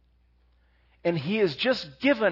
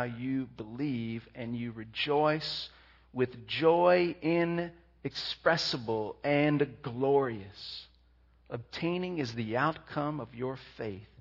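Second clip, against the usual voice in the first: scripture reading; 6 semitones lower, 5 dB quieter than usual. Acted. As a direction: linger on each phrase of the verse. Reads scripture passage slowly.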